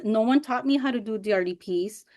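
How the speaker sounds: ordinary talk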